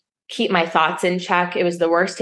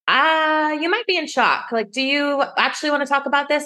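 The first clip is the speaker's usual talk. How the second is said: much higher, gentle voice of a concerned caller; worried caller voice; 10 semitones higher than usual. Retold or imitated. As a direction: sound like you're checking she's okay, pitched well up. Quoting caller, she sounds worried.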